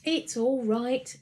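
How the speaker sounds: slow soothing reassurance; calm her down, slowly. A narrator's reading. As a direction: fire officer soothing a panicked mum. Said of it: "calming her down again".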